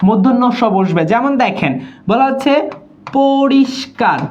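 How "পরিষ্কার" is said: "পরিষ্কার" drawn out, with i and sibilant held; slow, deliberate "পরিষ্কার"; slow syllable demo. Shown as a demonstration. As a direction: stretch "পরিষ্কার" so every sound is heard. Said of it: "ই উচ্চারণ হইছে".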